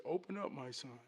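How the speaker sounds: soft, lightly voiced dad; father's voice. Father at the bathroom door, soft and calm. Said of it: "his daddy knocked at the door".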